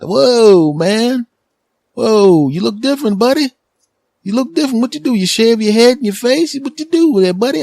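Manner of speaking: high, loud excited clerk; store clerk voice. Retold or imitated. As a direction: play the hyped-up shop guy calling out.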